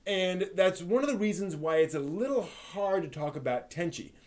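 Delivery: ordinary delivery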